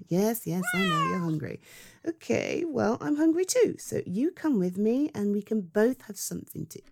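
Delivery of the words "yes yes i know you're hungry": ordinary delivery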